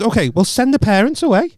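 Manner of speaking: retold demand, pitched high; exasperated demand. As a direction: re-enact an exasperated plea to the referee. Retold argument with the referee.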